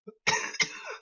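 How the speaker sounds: cough. Real, not acted. Sick speaker coughs.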